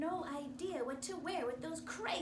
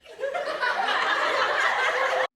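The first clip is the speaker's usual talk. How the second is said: put-on kids cackling loudly; loud mocking laugh; 11 semitones higher, 17 dB louder than usual. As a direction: cackle like the class.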